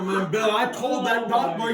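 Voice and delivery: ordinary delivery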